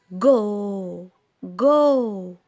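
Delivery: "جو" with the vowel drawn out; long vowel. Shown as a demonstration. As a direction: stretch the end of "جو". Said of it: "أنا مديت". ("جو" بمد طويل)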